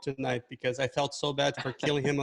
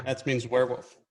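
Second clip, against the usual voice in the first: usual speaking voice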